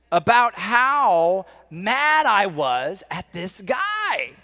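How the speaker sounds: drawn-out, hammed-up exasperation rising high; exasperated anger. Preacher confessing a years-old grudge to the congregation, exasperated. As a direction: stretch it out, climbing in mock fury.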